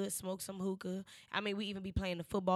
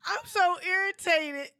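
laughing complaint; 11 semitones higher, 8 dB louder, slower than usual. Real, not acted.

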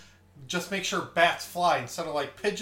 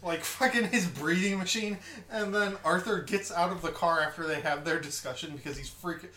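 normal talking voice

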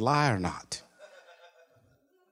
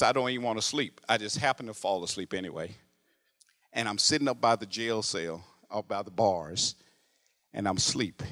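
ordinary talk, nothing marked

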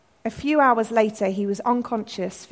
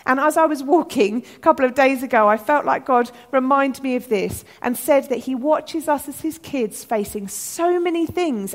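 usual speaking voice

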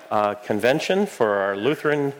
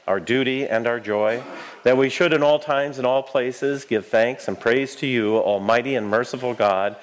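prayer. Real, not acted. Recites eucharistic prayer.

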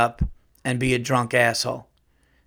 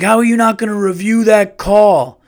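loud, higher mock yelling; outraged fan; 8 semitones higher, 8 dB louder than usual. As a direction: imitate an indignant fan protesting the call. Imitates irate fan disputing a call.

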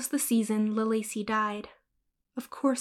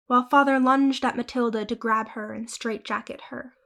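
ordinary delivery

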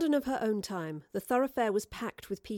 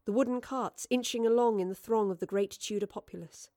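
ordinary delivery

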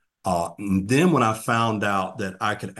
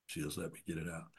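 hushed repeat; 3 semitones lower, 17 dB quieter, faster than usual. Real, not acted. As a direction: echo "She just let me get it out" much softer and a bit faster. Repeats line, emotional memory.

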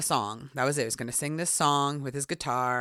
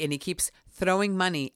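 ordinary talk

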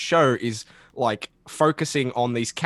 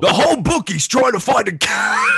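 louder, laughing shout; mock gruff shout; 8 semitones higher, 6 dB louder than usual. Reading the line as Wrecker, laughing shout. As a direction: bellow it with a laugh. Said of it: "give [name] a line to read like that".

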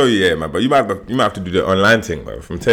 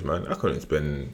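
usual speaking voice

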